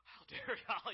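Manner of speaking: high, loud indignant cry broken by a laugh; laughing mock outrage. The girl rounds on the staff, laughing mock outrage, high and loud. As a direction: play her betrayed fury, laughing.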